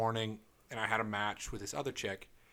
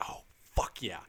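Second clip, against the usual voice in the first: whispered; 5 semitones lower than usual. Retold or imitated. Retold inner thought, quoted.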